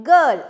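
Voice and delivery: put-on Girl said loud with a punched hard g; teacher models Girl with a hard g; hard g stressed. Shown as a demonstration. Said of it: "hard sound ആണ്".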